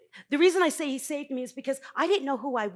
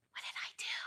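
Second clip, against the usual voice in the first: whispered to her husband; breathy whisper, no voice at all; 14 dB quieter, faster than usual. Retold or imitated. Wife whispering to husband after her talk.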